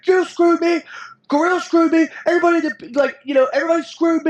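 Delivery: mock tantrum, as Bret losing his mind; hammed-up Bret Hart complaining he was screwed; Bret Hart impression. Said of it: "He's like".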